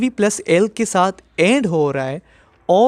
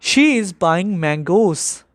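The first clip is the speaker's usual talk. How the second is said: each word of "She is buying mangoes" spelled out very slowly; a very slow model sentence; slow dictation; much slower than usual. Shown as a demonstration. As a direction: read it out word by word for the class. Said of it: "Another example".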